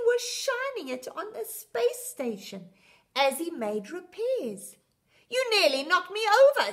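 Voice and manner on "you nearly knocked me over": startled. A narrator's reading. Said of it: "said Andy".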